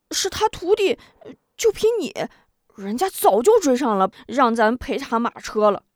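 frowning, sure he's right; puzzled simpleton. A narrator's reading. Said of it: "龙彪一时糊涂，皱皱眉道". (糊涂憨汉皱眉争辩，声音高)

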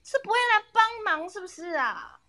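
high, sarcastic nagging tone; sarcastic scolding. Retold or imitated. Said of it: "他就会说".

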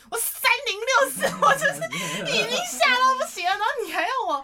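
又高又响、拖长的夸张哀嚎; 边笑边演出吓到不行的崩溃内心; 吓坏崩溃. Retold or imitated. 夸张演出当时吓坏的内心. (模仿吓坏时的高声哀嚎)

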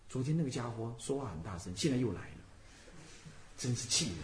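low, soft mock grumble; understated annoyance, kept inward; grumbling inner thought. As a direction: grumble privately under your breath. Voices a resentful thought during recitation.

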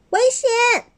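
high alarmed cry of warning; shout. A narrator's reading. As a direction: yell out the danger. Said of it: "利克拉大喊".

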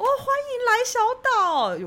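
high, slow, sing-song hostess greeting; overly warm welcome. Retold or imitated. Waitress greeting the island owner's family, eager and gushing. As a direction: gush a bright welcome at the guests. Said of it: "我就是一如往常的热情".

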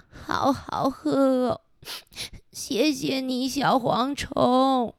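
slow, tearful, choked-up thanks; crying thanks. Acted. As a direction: speak through moved tears. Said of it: "哭着说". (感动得边哭边慢慢道谢)

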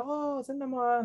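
high, soft "oh, really" wonder; imitated surprise. Customer hearing the goods are Japanese, mock surprise. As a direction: play a customer pleasantly surprised, softly.

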